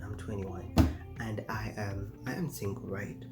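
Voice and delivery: ordinary delivery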